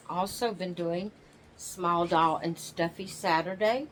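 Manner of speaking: usual speaking voice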